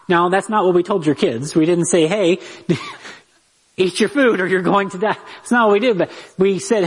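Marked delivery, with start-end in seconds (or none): 2.1-5.2 joking fake threat, giggling through it; laughing mock threat. Parent joking about threatening kids, laughing mock warning.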